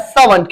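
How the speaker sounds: सर्वेंट with no R, SAA-vunt; correct servant pronunciation. Shown as a demonstration. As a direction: end सर्वेंट on a weak -vunt, not -vent. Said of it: "इसकी सही pronunciation होती है". (सर्वेंट का सही उच्चारण, सा-वंट)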